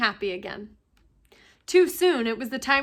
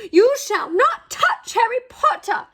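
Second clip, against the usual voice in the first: high, loud, slow elf voice guarding his master; a tiny servant standing up to his old master; fierce house-elf; 10 semitones higher, 7 dB louder than usual. A narrator's reading. House-elf shielding Harry from Lucius Malfoy, loud high fury.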